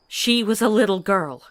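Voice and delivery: loud grieving protest; anguished outburst. Acted. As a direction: throw it at him. Grief-stricken rebuke.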